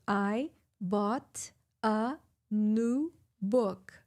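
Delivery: very slow, every word separated; slow, word by word as written. Shown as a demonstration. Teacher showing written English, slow and separated. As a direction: read each word apart like the textbook. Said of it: "se você vê escrito, tá escrito".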